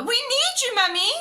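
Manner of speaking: high, loud plea for help; crying out. A narrator's reading. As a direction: call Mummy urgently. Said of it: "cried Peppa".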